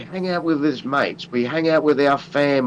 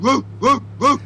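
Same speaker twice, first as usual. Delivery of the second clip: much higher, hyped-up boast; hype yell; 8 semitones higher than usual. Real, not acted. Hyping up his Lethal Toad persona, in an excited high boast. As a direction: pump yourself up like a fighter walking out. Hyping himself up as big dog.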